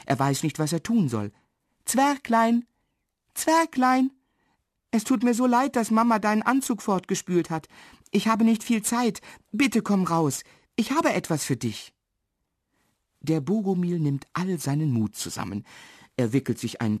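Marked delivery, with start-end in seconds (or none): 1.9-11.9 put-on high little-girl voice, coaxing; high-pitched apologetic plea; pleading girl. A narrator's reading. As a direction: coax someone out of hiding. Girl calls hidden dwarf, apologises.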